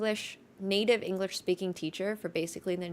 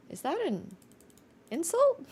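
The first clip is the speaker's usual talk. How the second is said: rising in disbelief, pitch jumping high on insult; baffled, is that an insult; confused; 9 semitones higher than usual. Retold or imitated. To commenters who call her Valley Girl, high incredulous rise. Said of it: "And I'm like".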